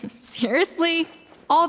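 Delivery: laughing in disbelief; a light laugh before the word; amused laugh. Acted. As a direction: laugh it off as nothing serious. Laughs off a confession as trivial.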